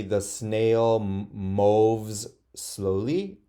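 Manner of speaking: slow, drawn-out reading; teacher reading a model sentence slowly. Shown as a demonstration. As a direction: read it very slowly to the class. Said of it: "you can say".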